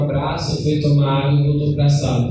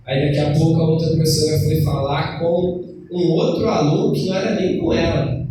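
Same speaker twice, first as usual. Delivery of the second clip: normal talking voice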